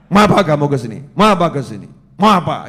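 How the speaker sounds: very loud, forceful challenge; gallant, authoritative. Shown as a demonstration. Preacher shows how to face an enemy, loud commanding challenge. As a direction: confront the foe with full authority. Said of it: "Ngadepi musuh tuh yang gagah, wibawa".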